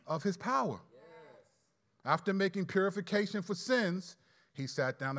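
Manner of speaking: loud, measured Bible reading to the congregation; scripture reading. Acted. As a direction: read the verses out with weight. Reads scripture passage aloud.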